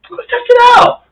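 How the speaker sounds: very high, bright and keen; mock eager. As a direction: jump at the chance to prove you're fine. Eager to test ankle.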